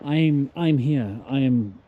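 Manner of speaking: normal talking voice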